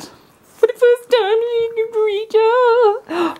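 high, slow, gushing over the puppy; excited baby-talk. Shown as a demonstration. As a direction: stretch it out, thrilled. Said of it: "repeated excitedly".

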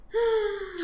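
high exaggerated sigh; falling, theatrical sigh; piglet's sigh. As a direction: let out a put-upon sigh. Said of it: "sighed the piglet".

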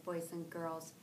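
usual speaking voice

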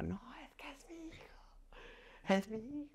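high, whispered imitation of a mother's wail; stifled mock scream, drawn out and very soft; retold cry of pain. Mother clinging to her son, imitated whispered wail of pain. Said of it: "los gritos de, de ella de, de dolor".

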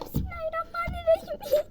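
Bismillahirrahmanirrahim wailed very high and tearful; high wailing prayer. Acted. Scared doll wails a prayer in the dark.

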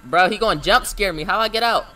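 trapped and frantic; scared horror game streamer. Real, not acted. Trapped in cameras, panicking.

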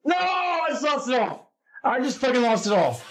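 high, wailed cry of loss; real dismay. Real, not acted. Gambler telling viewers he lost it all, dismayed. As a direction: wail at losing everything.

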